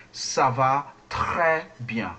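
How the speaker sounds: gargled R in "très", phrase drawn out; French R. Shown as a demonstration. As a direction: slowly model "très" with a throaty R. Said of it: "like you have water in your mouth".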